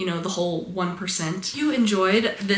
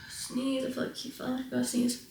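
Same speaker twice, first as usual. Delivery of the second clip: murmuring; 5 semitones higher, 11 dB quieter than usual. Real, not acted. Urging a stuck sneeze.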